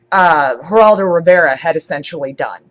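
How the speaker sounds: ordinary delivery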